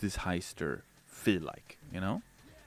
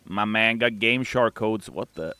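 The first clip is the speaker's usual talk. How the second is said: usual speaking voice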